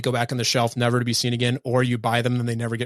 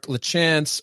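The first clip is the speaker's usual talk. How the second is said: ordinary talk, nothing marked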